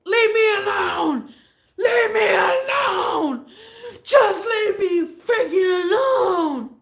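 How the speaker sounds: high, loud, slow tearful yell; hammed-up sobbing scream; crying shout. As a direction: wail to be left alone. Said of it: "he said this in Spanish".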